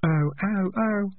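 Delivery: high, echoed call; echoing through pipes. Acted. Shout passed through the pipes, high echoing call. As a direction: let the call ring along the pipes. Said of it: "echoed through the pipes".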